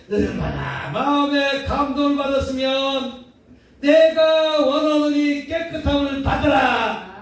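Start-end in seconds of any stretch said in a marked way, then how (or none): 3.8-7.2 slower, raised proclaiming voice; Jesus commanding. Acted. Preacher voicing Jesus healing the leper, raised and slow.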